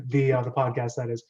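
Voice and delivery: usual speaking voice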